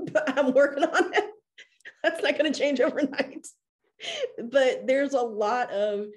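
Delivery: real laughter in the voice; laughing. Real, not acted. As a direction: talk through the laugh. Laughs about therapist's advice.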